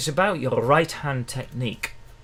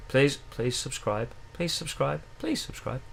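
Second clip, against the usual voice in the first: soft, fading pleading; hushed repeated plea; 8 dB quieter than usual. Real, not acted. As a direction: plead under your breath, getting softer each time. Repeated plea to viewers to subscribe.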